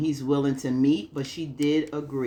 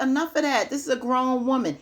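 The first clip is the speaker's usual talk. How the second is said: much higher, fed-up call-out; exasperated scolding; 10 semitones higher, 3 dB louder than usual. Real, not acted. Vlogger telling Karen to stop treating Pam like a child, exasperated and high. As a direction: tell Karen off, raising the pitch.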